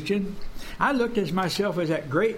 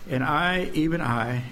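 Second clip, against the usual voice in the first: much lower, sinking into despair; despairing Elijah; 6 semitones lower than usual. Acted. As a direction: let the voice drop low and defeated.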